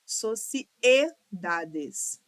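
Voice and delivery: "Sociedades" very slowly as so-ci-e-da-des, E apart; syllable by syllable. Shown as a demonstration. Teacher correcting the division, "Sociedades" syllable by syllable. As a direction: split "Sociedades" with the E on its own. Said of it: "Separa aqui".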